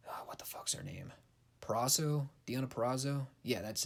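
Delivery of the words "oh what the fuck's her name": hushed, whispery mutter to oneself; muttered aside. Real, not acted. Podcaster groping for a wrestler's name, muttering under the breath. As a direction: drop to a near-whisper while searching your memory.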